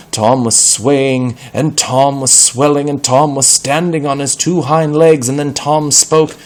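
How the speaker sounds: high, loud, spellbinding narration; suspenseful storytelling. A narrator's reading. Narrator building suspense around the swelling cat, loud and high. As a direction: build the tension as the cat transforms.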